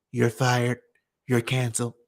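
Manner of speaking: mock YouTube boss. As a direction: deliver the firing like a cold boss. Voicing YouTube firing him.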